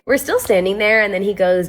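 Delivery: ordinary delivery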